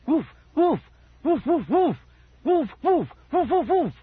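loud, high, repeated woofs; frantic dog barking. A narrator's reading. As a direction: bark insistently in bursts.

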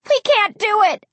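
high-pitched despair; desperate wail of frustration; frustrated, terrified cry. A narrator's reading. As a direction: cry out, panicking and beaten. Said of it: "I cried".

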